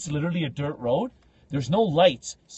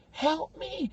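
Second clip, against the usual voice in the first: high, softly voiced ghost-girl plea; creepy girl; 13 semitones higher, 4 dB quieter than usual. Young girl running out in haunted house, creepy plea. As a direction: plead thinly like a horror-film girl. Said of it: "young girl ran out, like, the ring".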